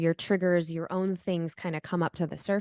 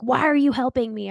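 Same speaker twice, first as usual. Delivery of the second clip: higher, louder mock yell; retold yell; 6 semitones higher, 7 dB louder than usual. New mother yelling at her mother-in-law, loud mock yell. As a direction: snap back at her like you did back then. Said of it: "I was, like, yelling at my mother-in-law".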